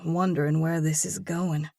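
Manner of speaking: plain reading voice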